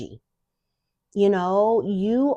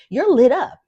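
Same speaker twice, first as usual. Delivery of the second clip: lit up; 5 semitones higher, 8 dB louder than usual. Real, not acted. Peak of lit-up energy.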